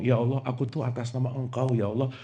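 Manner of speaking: soft, mumbled mock prayer; hushed lazy petition. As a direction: mumble it carelessly to God. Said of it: "Lagi sholat ngomong".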